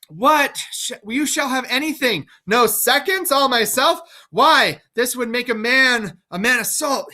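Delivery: a cornered king talking fast to his captors; frantic mad king. Acted.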